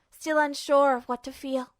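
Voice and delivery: ordinary delivery, nothing marked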